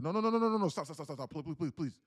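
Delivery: high, loud panicked pleading, imitated; frantic begging. Chat user begging the streamer not to block him, mock frantic pleading. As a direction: beg him wildly not to block you.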